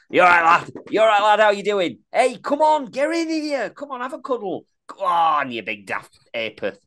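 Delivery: hearty Yorkshire greeting, loud and pitched high; Yorkshire accent. Acted. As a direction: put on a broad Rotherham accent, calling out high and matey. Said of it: "you're getting up to Yorkshire".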